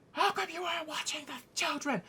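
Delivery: high, put-on scolding voice; hammed-up high-pitched reproach; bickering. Parent blaming guards over hurt children, bickering. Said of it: "just bickering like real children".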